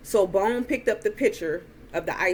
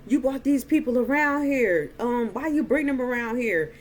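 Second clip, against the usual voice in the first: higher-pitched mock scolding; retold yelling; 6 semitones higher, 3 dB louder than usual. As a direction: imitate an angry man telling someone off. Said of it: "started yelling at Glenn".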